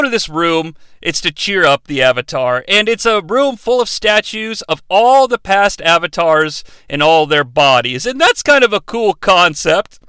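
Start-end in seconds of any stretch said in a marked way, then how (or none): none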